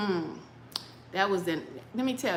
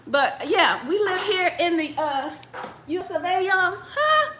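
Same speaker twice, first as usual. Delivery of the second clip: laughing through the words, higher; 8 semitones higher, 5 dB louder than usual. Real, not acted. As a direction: giggle it out, amused. Laughing at censorship irony.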